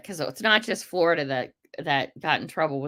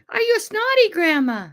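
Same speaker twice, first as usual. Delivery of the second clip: very high, louder put-on voice; mock granny teasing; 5 dB louder than usual. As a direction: squeak it out like teasing a grandma.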